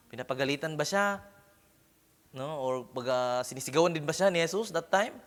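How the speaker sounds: ordinary talk, nothing marked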